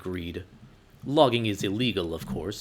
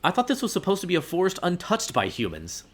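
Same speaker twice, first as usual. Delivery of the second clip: indignant; 8 semitones higher, 3 dB louder than usual. Acted. Indignant protest.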